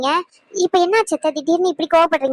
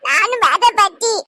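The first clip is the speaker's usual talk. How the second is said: put-on higher little boy insisting, slowing down; pleading child; 5 semitones higher, 3 dB louder than usual. Grandson begs grandma to let him come, pleading child voice. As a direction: beg to tag along like a small boy.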